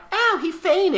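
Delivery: high-pitched mock yelp; mock game reaction. Sending up the game to the host, mock dismay. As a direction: put on a high little game voice. Mimics the game's mild reaction.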